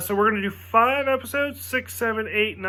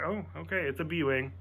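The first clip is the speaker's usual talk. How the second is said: soft, resigned okay; grudging concession; 2 semitones lower, 10 dB quieter than usual. Retold or imitated. Giving in to his friend, soft and resigned. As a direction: give in grudgingly.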